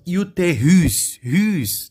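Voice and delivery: "Utérus, rus" slow, RUS punched high and loud; last syllable stressed. Shown as a demonstration. Teacher proving the last syllable is strongest, final stress. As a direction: hit "rus" hard at the end of "Utérus". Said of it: "tá vendo que é a mais forte?".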